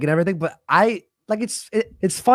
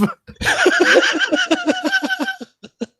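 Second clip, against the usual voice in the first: very high, long cackle; real shrieking laughter; laughing hard. Real, not acted. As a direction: crack up in a high laughing fit. Cracks up reading a donation.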